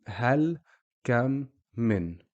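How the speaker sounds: "hal, kam, min" each a separate syllable stopped on its consonant; half sounds. Shown as a demonstration. Teacher recapping sukoon, stopped endings. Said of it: "We just make half the sound".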